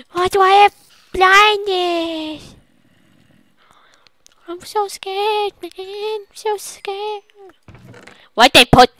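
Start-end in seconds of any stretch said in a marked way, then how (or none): 0.1-7.2 mock scared. Frightened character voice.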